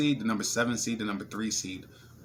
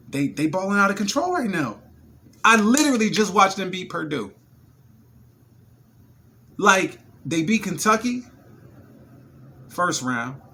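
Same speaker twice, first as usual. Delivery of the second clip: excited; 7 semitones higher, 8 dB louder than usual. Real, not acted. Hyped recounting the upset wins.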